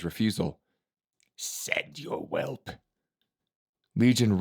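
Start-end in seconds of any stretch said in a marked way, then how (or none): 1.4-2.7 menacing high whisper. A narrator's reading. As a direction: breathe it out slowly, unvoiced. Silent Master, unvoiced.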